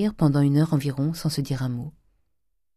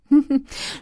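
sharp high miser's cackle; gleeful cackle; 12 semitones higher, 5 dB louder than usual. A narrator's reading. Grandet cackling to his wife over his trick. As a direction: laugh at the buyers you fooled. Grandet laughs mid-boast.

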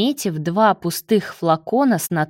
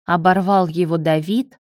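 usual speaking voice

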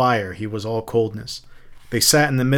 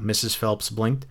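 ordinary delivery

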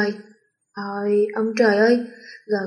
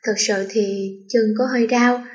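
usual speaking voice

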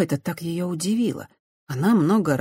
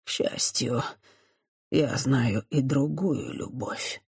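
softer, slow and calm; much calmer; 2 semitones lower, 6 dB quieter, slower than usual. A narrator's reading. Priest calmly answering Christiana, softer. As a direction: settle into calm. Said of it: "уже куда спокойнее продолжил он".

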